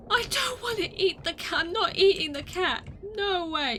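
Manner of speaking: high, loud protest; mock-horrified refusal. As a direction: cry out in playful outrage. Refuses to eat the cat.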